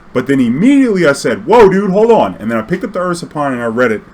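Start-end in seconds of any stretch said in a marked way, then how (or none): 1.5-2.3 sudden high, loud "whoa, hold on"; startled exclamation. Retold or imitated. Player catching his own mistake at Nationals, startled and loud. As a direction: catch yourself mid-game, alarmed.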